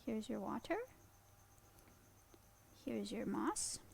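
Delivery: soft, gentle praise to a pet; quiet doting pet talk; tender. Real, not acted. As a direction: thank the little bug softly. Talking softly to her pet.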